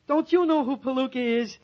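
loud, incredulous question; frustrated outburst. Acted. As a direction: demand it, out of patience. Exasperated with librarian, warned about voice.

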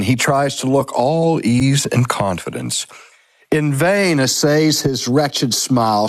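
usual speaking voice

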